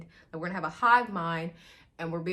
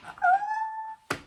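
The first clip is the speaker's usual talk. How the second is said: very high drawn-out "oh"; swooning squeal. Real, not acted. Fan reacting to the flirting, high swooning squeal.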